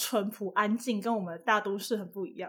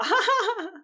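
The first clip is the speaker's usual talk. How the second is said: high bright laugh; real laugh at a funny story; burst of laughter; 10 semitones higher than usual. Real, not acted.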